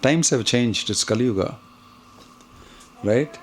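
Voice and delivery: usual speaking voice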